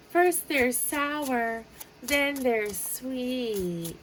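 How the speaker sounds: sour then sweet. Pretend. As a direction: stretch it out, sour first then sugary.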